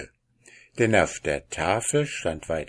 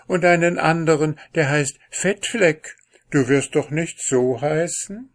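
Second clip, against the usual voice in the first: put-on high, louder girlish princess; princess, chattering; 6 semitones higher, 5 dB louder than usual. A narrator's reading. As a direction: voice a lively princess reasoning aloud. (lebhafte Prinzessin, hoch und laut)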